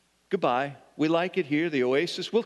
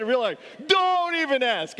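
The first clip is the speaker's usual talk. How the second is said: a cheerfully grumpy sigh of a line, pitched way up; grumpy cashier; 10 semitones higher than usual. Retold or imitated. Cashier answering the pastor's question in a high, mock-weary voice. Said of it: "She said".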